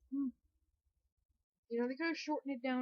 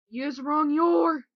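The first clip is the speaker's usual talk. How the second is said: drawn-out loud; 5 semitones higher, 13 dB louder, much slower than usual. Acted. Odd inverted character line.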